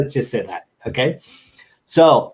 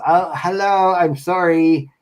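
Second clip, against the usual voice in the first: lightly voiced old lady, higher pitch; apologetic wrong-number caller, slightly put on; 5 semitones higher than usual. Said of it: "like a weird old lady voice".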